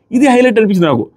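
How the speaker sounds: usual speaking voice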